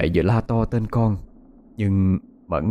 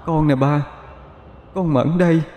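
ghost son; 7 semitones higher than usual. A narrator's reading. Dead son's ghost speaks.

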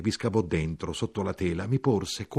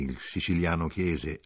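ordinary delivery

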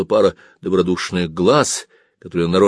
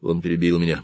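plain reading voice

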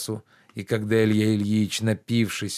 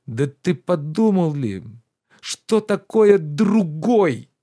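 higher, louder reproachful gentleman; lordly rebuke, pitched up and loud; indignant master Oblomov; 6 semitones higher, 6 dB louder, slower than usual. Acted. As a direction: scold the servant with wounded pride. Narrator voices indignant master.

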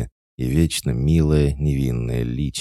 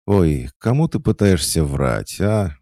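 louder, higher scoffing jab; mocking smirk; 7 semitones higher, 6 dB louder than usual. A narrator's reading. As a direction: scoff at him, amused. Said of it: "усмехнулась Матильда". (насмешливо, с усмешкой)

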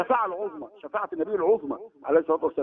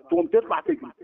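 mock alarm; 11 semitones higher than usual. Mocking listener who runs away.

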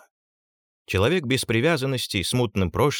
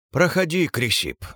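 hollow voice like grinding stone; muffled stony voice; 6 semitones higher than usual. Acted. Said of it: "донёсся его глухой каменный голос".

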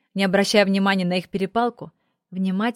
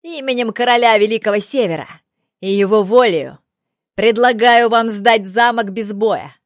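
put-on loud formal proclamation from a young envoy; ringing, projected youthful voice; young, ringing herald; 7 dB louder than usual. A narrator's reading. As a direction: call the surrender terms up to the battlements. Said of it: "на удивление молодой и звонкий".

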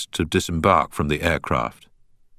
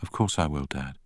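soft whisper in his ear; 3 semitones lower, 7 dB quieter than usual. A narrator's reading. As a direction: whisper it close. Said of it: "simply whispered in his ear".

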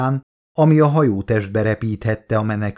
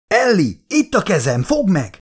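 higher urgent shout; 7 semitones higher than usual. Acted. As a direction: call out to her over the wind. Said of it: "kiabálta Charlie". (magasabb, sürgető kiáltás)

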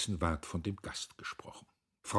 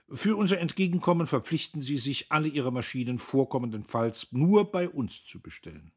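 firm, raised, businesslike voice; matter-of-fact; 8 semitones higher, 8 dB louder than usual. A narrator's reading. Agent names his price to factory owner, matter-of-fact. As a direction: state the terms briskly as business. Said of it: "Kienast sagte sachlich".

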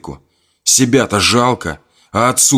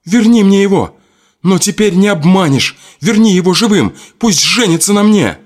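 high loud demand; high loud desperate demand; desperate shout; 11 semitones higher, 4 dB louder than usual. Acted. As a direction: scream the demand. Adelka demands groom back.

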